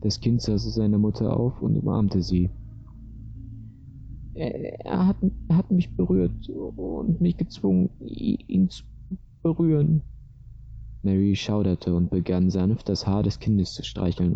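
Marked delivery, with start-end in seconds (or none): 4.3-10.0 put-on high, broken child's voice; sobbing little girl. A narrator's reading. Frightened girl telling her mother, high tearful stammer. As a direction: stammer it out through tears, high-pitched. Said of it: "begraf das Mädchen schluchzend heraus".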